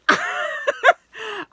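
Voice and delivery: high, loud burst of laughter; delighted laugh. Real, not acted. Showing the Saturn charm, laughing with delight.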